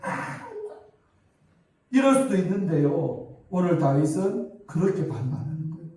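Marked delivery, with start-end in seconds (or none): 0.0-0.5 very high, soft pretend crying; hammed-up wail of a wronged child; mock wail. Pastor as wronged David crying like Hanna, mock wail. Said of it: "막 억울해서 막 한나처럼 막 울고".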